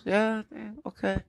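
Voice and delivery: lightly voiced grandma accepting limes; a hint of a sweet abuelita; old lady voice. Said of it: "She goes".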